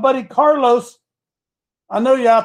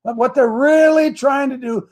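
normal talking voice

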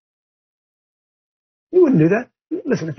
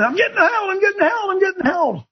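mocking whiner; 13 semitones higher than usual. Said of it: "They're complaining".

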